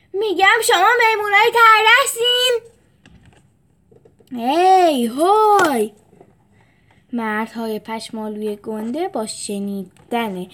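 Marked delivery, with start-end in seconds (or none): 0.1-2.6 high, loud taunting call; louder. A narrator's reading. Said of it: "و بلندتر گفت". 4.3-5.9 loud gruff shout; angry yell. A narrator's reading.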